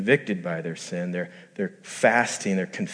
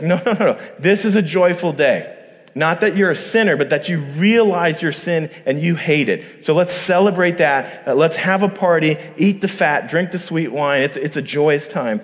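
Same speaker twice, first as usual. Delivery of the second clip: joyful; 5 semitones higher, 5 dB louder than usual. Retold or imitated. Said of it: "Here's how the leaders directed them".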